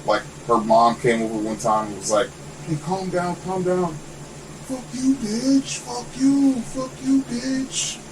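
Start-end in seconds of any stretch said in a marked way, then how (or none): 2.7-3.9 high imitation of a worried mother; pleading mom. Voices neighbor's mom pleading. 4.7-8.0 very high, slow imitation of an unhinged neighbor; high-pitched mock rant; mock screeching curses. Voices crazed neighbor cursing back.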